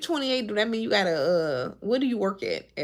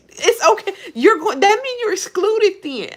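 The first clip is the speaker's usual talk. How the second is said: exasperated rant; 11 semitones higher, 7 dB louder than usual. Real, not acted. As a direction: push it up high and loud, fed up. Heated rant about seafood allergies.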